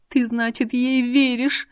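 high, tense accusing question; a high-pitched take on an angry hiss; hissed accusation. A narrator's reading. Irina challenges Artur for believing the other woman, hissing. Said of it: "прошипела Ирина".

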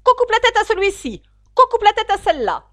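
very loud, high raging queen; queen condemning players, yelling; furious shout. Acted. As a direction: scream the sentences. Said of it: "de crier".

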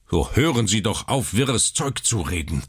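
a touch of a sharp rebuke in a changed voice; mildly sharp doctor's rebuke. Acted. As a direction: cut him off sharply. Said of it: "sagte Doktor Keene scharf".

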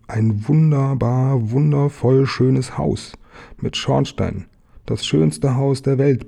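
drawn-out, swooning admiration; awed delight. Acted. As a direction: savour each word in wonder. Tiger marvels at the house.

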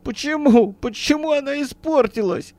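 high-pitched plaintive lament; tearful wailing. A narrator's reading. Businessman laments over his broken car, tearful and wailing. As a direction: wail it helplessly, near tears. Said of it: "со слезами на глазах причитал Бирюков".

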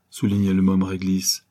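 ordinary delivery, nothing marked

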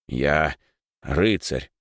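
mildly reluctant, halting answer. Acted. Boy answers his friend after a long silence, reluctantly. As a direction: answer reluctantly, pausing between words. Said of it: "неохотно ответил он".

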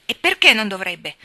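loud, defiant challenge; angry retort. A narrator's reading. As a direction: snap back, eyes flashing with anger. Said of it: "alzando gli occhi lampeggianti d'ira".

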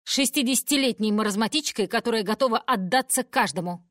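loud furious mother's tirade; angry shouting. Acted. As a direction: yell it at grandma. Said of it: "кричит мама". (разгневанный крик матери)

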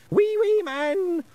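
put-on very high, loud little-boy squeal; toddler yell. A narrator's reading. Small boy yelling at the pixies, piercingly high. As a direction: yell it like an excited small child. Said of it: "yelled Wentworth".

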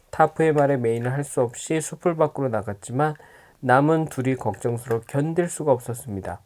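ordinary delivery

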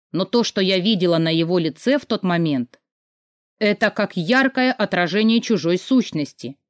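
ordinary delivery

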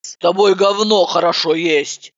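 plain reading voice

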